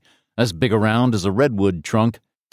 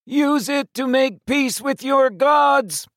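very high, slow croaking alien voice; menacing alien through a speaker; croaking metallic voice; slower than usual. Acted. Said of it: "a croaking metallic voice".

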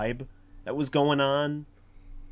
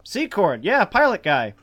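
higher, louder mock-casual quote; cafe friends covering; 11 semitones higher, 7 dB louder than usual. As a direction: act out pals playing dumb, pitched up. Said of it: "They're like".

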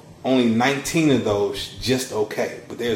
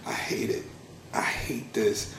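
whispered near tears, softer, mild retelling; retold tearful whisper; 12 dB quieter than usual. Telling the supervisor on his first day, a soft retold whisper. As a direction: breathe it out like holding back tears.

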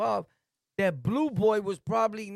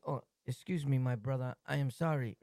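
meek polite apology; 7 semitones lower, 6 dB quieter than usual. Retold or imitated. Acting overly polite apology.

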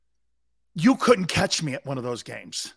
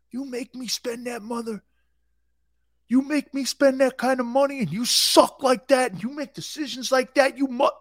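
angry fan rant; 6 semitones higher than usual. Pretend. A furious fan ranting at his own team, yelling. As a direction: rant like a furious season-ticket holder. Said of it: "do I sound like that?".

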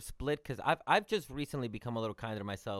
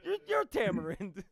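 laughing quote; 11 semitones higher, 8 dB louder than usual. Retold or imitated. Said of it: "everybody goes".

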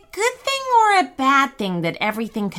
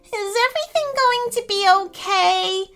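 wailing; 11 semitones higher than usual. Acted. Little brother seeking reassurance in the storm, anxious wail. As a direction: wail it like a frightened little boy. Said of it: "Gus wailed".

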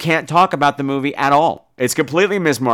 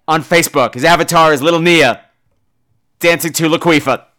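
ordinary talk

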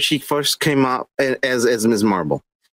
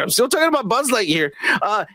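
normal talking voice

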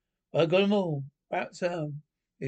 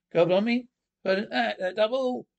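high, loud parade-ground call; sergeant's calling out; 5 semitones higher, 5 dB louder than usual. Acted. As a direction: call the missing private over, loud and high. Sergeant calls for a missing private.

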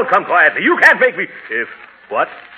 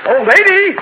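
calling out; 5 dB louder, slower than usual. Acted. Calling after woman down street.

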